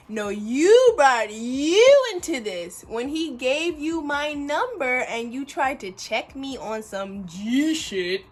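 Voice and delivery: loud, drawn-out retort with YOU punched; indignant clapback. Retold or imitated. Talking back to his little chick, loud indignant clapback.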